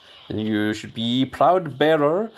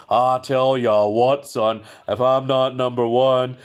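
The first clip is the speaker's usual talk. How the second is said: Stone Cold Steve Austin; 4 dB louder than usual. Pretend. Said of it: "'cause Stone Cold said so".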